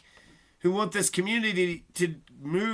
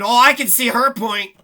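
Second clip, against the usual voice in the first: a hint of cold seriousness, a bit louder; lightly voiced serious cashier; mock serious clerk; 3 semitones higher, 7 dB louder than usual. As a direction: imitate the clerk going stiff and serious. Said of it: "she got real fucking serious".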